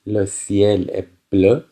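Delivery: very slow, careful French reading; slow, clear dictation. Shown as a demonstration. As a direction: read it out slowly for learners. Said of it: "teacher reads French slowly".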